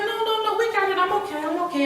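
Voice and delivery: high, light and insistent; high reassuring. Retold or imitated. To husband, insisting she is fine, high and bright. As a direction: talk him out of worrying, high and bright.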